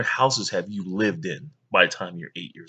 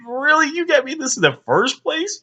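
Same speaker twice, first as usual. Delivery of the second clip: put-on much higher, louder scoffing kid; kid unimpressed; 4 dB louder than usual. Boy scoffing at his checkers present, high mock disdain. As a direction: scoff at a lousy gift. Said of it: "something I was like".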